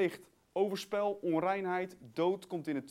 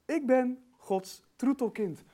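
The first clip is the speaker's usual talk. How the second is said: slow, spaced-out, measured declaration; deliberate affirmation; 4 semitones higher, 4 dB louder, slower than usual. Shown as a demonstration. Preacher modelling what to tell the mirror, deliberate affirmation. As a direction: let each word sink in slowly.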